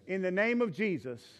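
phrase by phrase with long pauses for the church to repeat; measured call-and-repeat prayer; led prayer. Real, not acted. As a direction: lead the prayer line by line. Leads congregation in repeated prayer.

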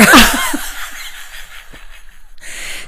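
airy unvoiced laugh; airy laugh at the slow walk joke; breathless laugh. Real, not acted. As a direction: laugh it out almost silently.

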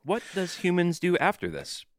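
stilted android asking about humans; mechanical, over-even questioning; robot voice. Pretend. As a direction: play along as a puzzled machine. Joins in the robot bit.

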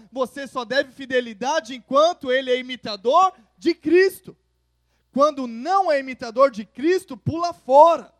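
loud, rising sermon climax; fervent pulpit proclamation; full-force preaching. Acted. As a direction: preach it out to the whole church.

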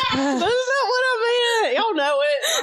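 high squealing laugh, then protesting. Real, not acted. Laughing at misread remark.

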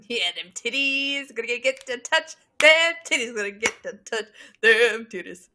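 goofy put-on voice. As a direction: stretch it out like a goofball. Co-host clowning over the story.

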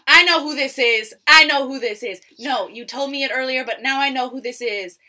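loud, high burst of recognition; excited recognition. Real, not acted. Recognizing the case her cohost named, excited and loud. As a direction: blurt it out, thrilled to know.

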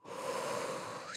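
long audible breath, acted out; reenacted steadying breath; deep breath. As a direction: take a slow, deep breath and let it out. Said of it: "took a deep breath".

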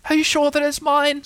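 high, eager small-animal voice; Piglet's excited squeak. A narrator's reading. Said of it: "squeaked Piglet excitedly".

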